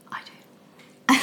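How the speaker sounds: unvoiced whispered confession; whispering. Real, not acted. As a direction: whisper it like a guilty secret. Admitting a grudge, whispered aside.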